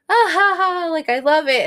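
high-pitched imitation of giggling kids; bright-pitched mock laughing delight; delighted children. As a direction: mimic kids loving a funny story. Voices delighted children hearing the story.

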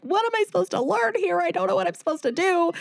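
high, tearful pleading to God; retold ugly cry. In the shower, pleading with God through mock ugly cry. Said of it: "doing the ugly cry and just praying".

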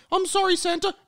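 high, meek intern voice; squeaky apology. Acted. Intern apologising to Santa, high and meek. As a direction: squeak out a timid sorry.

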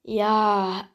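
usual speaking voice

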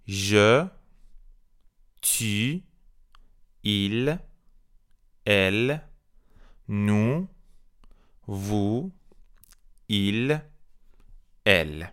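one word at a time, long pauses between; repeat-after-me drill. Shown as a demonstration. French teacher has learners repeat pronouns, spaced-out drill. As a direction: model each pronoun separately for learners. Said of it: "بعد من بگین".

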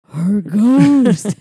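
loud, drawn-out ghostly announcement; dramatic mock reveal. Whole diner revealing the truth, loud mock-dramatic. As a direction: proclaim it like a spooky punchline.

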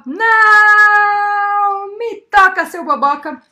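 loud, high, long-held "não"; shout. A narrator's reading. Girl shouting at the neighbour as she flees, loud shout. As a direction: yell it full out, stretching the first word.

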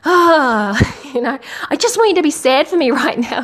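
put-on a kid groaning at Mum's lecture; whiny kid. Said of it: "They're like".

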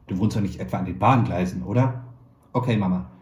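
plain reading voice

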